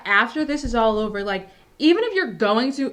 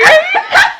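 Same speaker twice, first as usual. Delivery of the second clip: high shrieking burst of laughter; 12 dB louder than usual. Real, not acted. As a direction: explode into a loud squealing laugh. Bursts out laughing mid-story.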